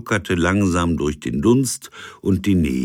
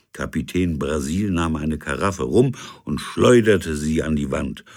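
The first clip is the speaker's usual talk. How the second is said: ordinary delivery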